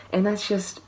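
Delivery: ordinary talk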